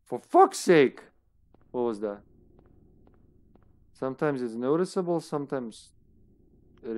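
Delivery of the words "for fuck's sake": loud, exasperated curse jumping up in pitch; exasperated outburst. Real, not acted. Gamer cursing at a game setback, exasperated. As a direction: burst out swearing, fed up.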